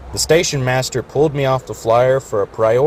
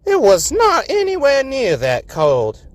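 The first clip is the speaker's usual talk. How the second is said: much higher, slow scoffing voice; scoffing engine. A narrator's reading. Yonah contradicting Governor, high and slow. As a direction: pop his bragging bubble. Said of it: "Yonah said".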